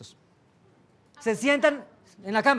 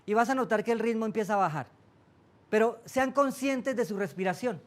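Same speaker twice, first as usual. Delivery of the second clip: guided meditation. Acted. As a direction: lead them gently into calm breathing. Guía una meditación. (voz de meditación guiada, suave)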